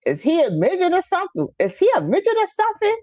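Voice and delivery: high, fast mock of the aunt's country accent. Imitating her aunt asking about her short nephew. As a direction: ham up the aunt's blunt question. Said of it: "with her little accent".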